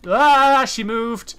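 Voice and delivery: sudden loud high yelp; real fright, loud and high; startled. Real, not acted. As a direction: jump at the movement. Startled by hanging body moving.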